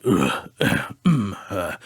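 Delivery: mumbled, throaty grunting; deep trucker grunts. Retold or imitated. Dreamer imitates a stranger bent over the dumpster, gruff trucker grunting. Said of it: "grunting with this really deep, like, trucker voice".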